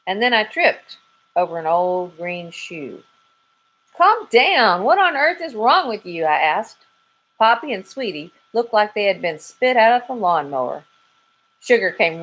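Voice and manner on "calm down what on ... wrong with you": alarmed scolding, pitched up; exasperated. Acted. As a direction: shake them out of the panic. Dog scolding frantic chickens.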